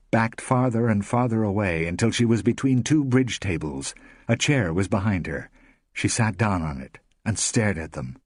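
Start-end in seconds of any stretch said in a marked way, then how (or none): none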